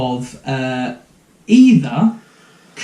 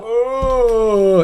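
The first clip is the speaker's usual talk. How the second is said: zombie moan; 8 semitones higher than usual. Retold or imitated. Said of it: "Shambling about".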